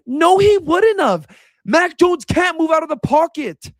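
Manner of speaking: high, emphatic exasperated denial; heated, high-pitched contradiction; indignant rebuttal. Real, not acted. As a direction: shoot the claim down, pitched high. Rebutting a fan's claim.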